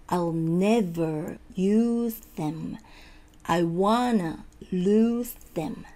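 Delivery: drawn-out model phrases for learners; very slow, each word held apart; slow practice. Shown as a demonstration. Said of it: "ゆっくりやっていって". (ゆっくり一語ずつ手本を示す)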